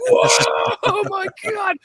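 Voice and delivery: high laughing yelp of shock; startled whoa. Retold or imitated. Kid sees the first zombie, high laughing shock. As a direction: jump out of your skin, then laugh.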